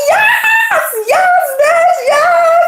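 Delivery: very high, squealed celebration; excited. Retold or imitated. Reliving her celebration after he left, excited. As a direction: re-enact a gleeful victory squeal. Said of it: "with excitement".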